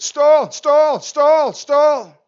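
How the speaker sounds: blaring alarm. Retold or imitated. Preacher imitating the stall warning blaring over the pilots, loud and mechanical. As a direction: blast it like the alarm. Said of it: "The voice is still going".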